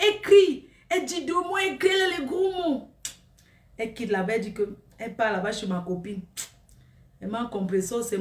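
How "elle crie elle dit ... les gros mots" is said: mocking the screamer. Mocks the shouting girl.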